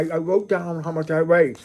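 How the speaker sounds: ordinary talk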